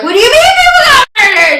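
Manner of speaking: high, loud, fast retold shriek; freaked-out disbelief. Driver reacting to news of murders at their lodging, freaked out. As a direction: shriek it back in alarmed disbelief. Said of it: "So I'm freaking out".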